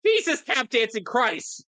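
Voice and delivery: high, startled outburst; shocked exclamation. Real, not acted. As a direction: blurt it out in shock.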